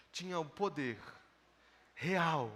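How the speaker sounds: ordinary talk, nothing marked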